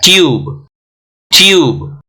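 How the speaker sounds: ट्यूब with a long यू; long-u tube. Shown as a demonstration. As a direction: stretch the यू in ट्यूब. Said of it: "यू यहाँ पे लंबा खींच रहा है".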